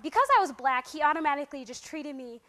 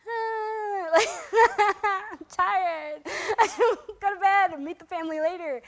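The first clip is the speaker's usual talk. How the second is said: hammed-up, higher whimpering complaint; mock tired whine; 5 semitones higher, 6 dB louder than usual. Tired newcomer wanting bed, mock-whiny. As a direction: whine it through little laughs. Acts out own tired reluctance.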